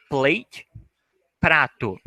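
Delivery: ordinary talk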